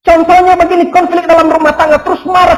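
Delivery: loud, high, driving sermon voice; fervent preaching. Acted. Pastor urging the congregation, loud fervent preaching. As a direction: preach it out to the whole church.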